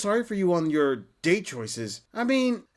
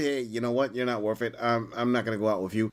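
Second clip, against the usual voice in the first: voicing the other guy; 8 semitones lower than usual. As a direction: lower voice, blunt guy brushing her off.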